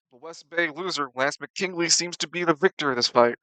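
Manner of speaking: loud, booming announcer call; fight announcer. Acted. Announcer declares Lance the victor, loud announcer voice. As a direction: proclaim the winner to the crowd. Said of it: "The announcer".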